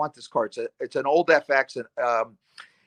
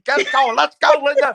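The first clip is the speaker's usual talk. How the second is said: high, raised, playful holler; laughing scream; 10 semitones higher, 5 dB louder than usual. Retold or imitated. Salesman urging his late mom to help with his bonus, laughing scream. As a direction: cheer her on loudly, high and half laughing. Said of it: "Laughing, screaming".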